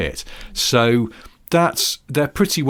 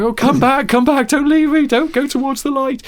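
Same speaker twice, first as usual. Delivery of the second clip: wounded soldier gasping; 8 dB louder than usual. Pretend. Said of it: "it's sort of".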